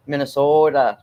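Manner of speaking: Minnesota with a long, drawn-out O. Shown as a demonstration. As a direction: stretch the O in "Minnesota". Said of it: "they say I draw out my O's".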